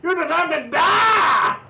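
shouted battle cry. Pretend. Shooting the giant.

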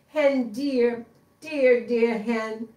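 put-on a desperate fox begging the old woman; pleading fox. Fox begs the hen.

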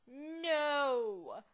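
loud, drawn-out mock-anguished cry; dramatic wail. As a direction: wail it out at full volume. Louder repeat, croissant stolen.

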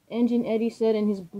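usual speaking voice

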